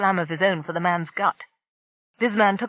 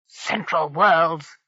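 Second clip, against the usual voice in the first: slow, drawn-out growl; 2 semitones lower, much slower than usual. A narrator's reading. Pirate Bisman realizing the trap, a slow growl. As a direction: spit the words out as a menacing growl. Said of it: "Bisman growled".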